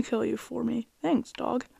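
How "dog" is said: ordinary talk, nothing marked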